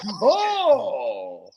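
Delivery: "Duval" with a long drawn-out second syllable; misplaced stress. Retold or imitated. Imitating the coach's botched "Duval", long drawn last syllable. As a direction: stretch the end of "Duval" in imitation. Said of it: "he put the accent on the wrong part of it".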